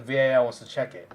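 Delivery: normal talking voice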